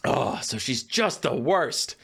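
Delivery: ordinary talk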